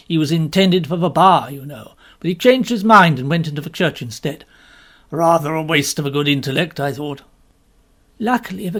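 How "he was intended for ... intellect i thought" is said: a hint of a lower, louder gentleman; lightly voiced Woodhouse. A narrator's reading. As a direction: read him a shade lower.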